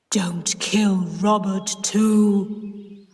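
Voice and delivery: slow, solemn ghostly warning; a lightly voiced ghost, slow and grave; slow ghost voice pleading for Robert; deep terrible voice. A narrator's reading. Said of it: "in a deep, terrible voice".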